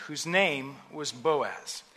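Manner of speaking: ordinary talk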